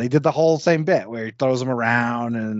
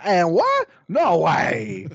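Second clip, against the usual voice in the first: higher, hammed-up unconvincing imitation; mock an actor straining to sound cool; bad impression; 5 semitones higher, much slower than usual.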